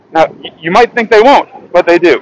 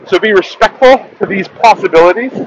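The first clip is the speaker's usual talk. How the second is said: ordinary delivery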